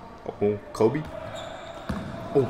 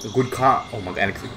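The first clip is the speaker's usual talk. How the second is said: normal talking voice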